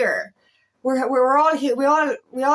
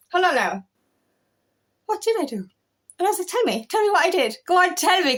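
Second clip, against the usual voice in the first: high, daring, teasing challenge; mock demand; 8 semitones higher, faster than usual. Speaker daring the Irish woman in the pub, high teasing challenge. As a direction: dare her to say it, voice up high.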